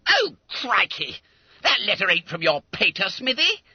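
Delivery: high schoolboy squeal of disgust; disgusted dismay. Acted. Fat schoolboy seeing his chum got only a bill, disgusted. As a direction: protest in a high, let-down voice. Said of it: "gave a snort of utter disgust".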